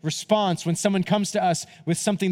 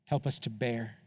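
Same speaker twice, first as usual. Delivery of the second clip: closing prayer; 4 semitones lower, 8 dB quieter than usual. Acted. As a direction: pray gently for the whole room.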